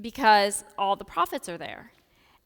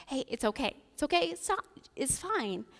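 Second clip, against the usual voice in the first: soft hushing reassurance; calm someone down gently; soothing Jesus; 3 semitones higher, 6 dB quieter than usual. Retold or imitated. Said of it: "And just like".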